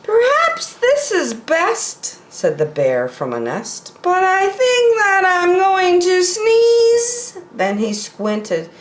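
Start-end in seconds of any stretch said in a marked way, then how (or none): 0.0-2.2 slow, high polar bear voice; hopeful, pitched well up and drawn out. Acted. Polar bear in a nest hopes it will do, high and slow. 4.0-7.4 slow, high polar bear about to sneeze. Acted. Polar bear in the trees feels a sneeze coming, high and slow.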